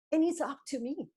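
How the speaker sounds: ordinary talk, nothing marked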